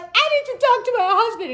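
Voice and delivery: high, loud wail; mock tearful protest. Crying driver refusing to hang up, tearful wail. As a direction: plead it as if in tears. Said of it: "I'm still in tears".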